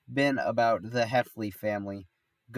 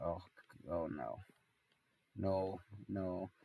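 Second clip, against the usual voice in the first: quiet dread; 2 semitones lower, 11 dB quieter, slower than usual. Retold or imitated. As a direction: mutter it with sinking dread. Retold dread at being called.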